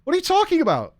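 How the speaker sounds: high incredulous question; baffled staff guy. Retold or imitated. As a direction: sound completely thrown by the claim.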